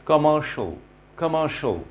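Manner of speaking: "কমার্শিয়াল" ending in a short "sho"; correct pronunciation. Shown as a demonstration. Teacher models the word for learners, correct pronunciation. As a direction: say "কমার্শিয়াল" as "kuh-MAR-sho". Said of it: "আসলে এর pronunciation হবে".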